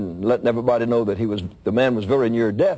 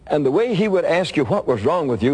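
usual speaking voice

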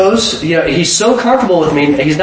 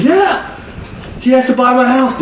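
higher, eager "yeah"; excited confirming; 10 semitones higher than usual. Retold or imitated. The dentist confirming to his buddy, high and eager. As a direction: nod it through with glee.

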